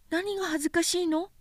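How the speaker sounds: put-on high, soft, quick child voice; helpful child. A narrator's reading. Prince trying to help the drunkard, high gentle child voice.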